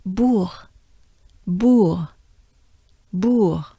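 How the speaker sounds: "bourg" with a rounded ou and a throaty French R; French pronunciation. Shown as a demonstration. Teacher repeating "bourg" for learners. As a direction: say "bourg" the French way, breathy R at the end. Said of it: "as I said".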